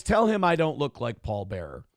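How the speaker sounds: usual speaking voice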